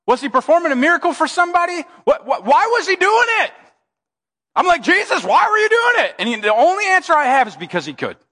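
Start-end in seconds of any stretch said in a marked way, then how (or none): 2.0-3.5 high-pitched, baffled demand; hammed-up bewilderment; mock exasperation. Exasperated questioning of Jesus' motive. 4.8-6.1 high, exasperated question to Jesus; mock exasperation; baffled demand.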